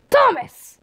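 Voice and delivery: high, sudden mild yell. A narrator's reading. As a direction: burst out with the name. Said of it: "Tord yelled without thinking".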